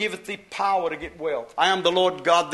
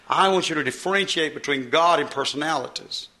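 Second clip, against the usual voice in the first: ordinary talk, nothing marked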